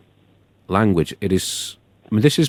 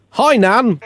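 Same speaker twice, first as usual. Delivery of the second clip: much higher bright hello; cheery greeting; 10 semitones higher than usual. Acted. Andy greets his nan at the door, high cheery. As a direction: greet her sweetly.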